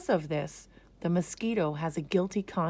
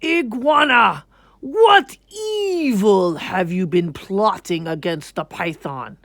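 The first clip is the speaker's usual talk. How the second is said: king's interrogation; 2 semitones higher, 11 dB louder than usual. A narrator's reading. King Lion questioning the iguana about plotting, loud and accusing. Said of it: "Then he asked".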